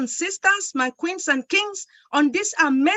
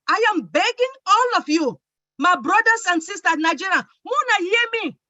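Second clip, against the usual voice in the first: ordinary talk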